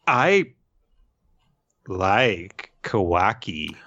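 slow, with long pauses between words; halting confession. Acted. Ada confesses her secret crush, slow halting mock confession. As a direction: draw out a mock-bashful admission word by word.

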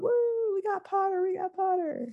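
high cheering chant. Acted. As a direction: whoop it like a cheering crowd. Tables cheering.

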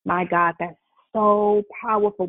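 usual speaking voice

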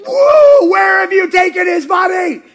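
high, loud, laughing demand; frantic demand. Acted. Mary confronting the gardener, loud and high with laughter.

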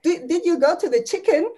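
mock New Zealand question; New Zealand accent. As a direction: ask it imitating a New Zealander's short vowels. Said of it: "when they say".

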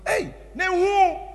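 high, slow amazed call; astonished cry. Retold or imitated. Preacher reliving his soul leaving body, slow high astonishment. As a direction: marvel aloud at what happens.

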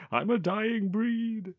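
high, drawn-out comic wail; mock crying. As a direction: wail it slowly in pretend despair. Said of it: "Just crying".

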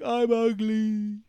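very slow sulky whine; mopey whine. Retold or imitated. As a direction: drag it out pitifully. Hero sulks.

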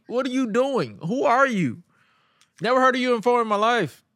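high, mock-outraged questioning; incredulous outburst. Acted baffled challenge to the wrestler.

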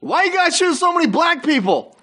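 yelling. Acted. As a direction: shout it right into the mic. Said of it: "Yelling directly into that mic".

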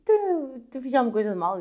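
high, loud mock protest; incredulous shout. Retelling what she said to the lady, loud incredulous. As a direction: shout it in disbelief. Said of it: "fiquei só".

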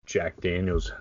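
ordinary delivery

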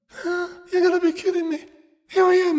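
high-pitched, baffled disbelief; acted squeaky-high astonishment; incredulous. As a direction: react as if you can't believe it, voice way up. Acted disbelief at friends' speed.

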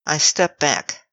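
ordinary delivery, nothing marked

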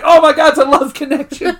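high, loud gushing imitation; mock excitement. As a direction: gush in hammed-up amazement. Acting Creed's excited outburst.

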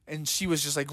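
ordinary delivery

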